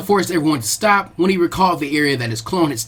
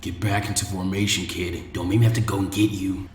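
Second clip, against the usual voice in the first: lower, softer, firm sensei; stern teacher's low voice; 9 semitones lower, 7 dB quieter than usual. Acted. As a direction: quietly order the kid back. Asuma thinks at Naruto.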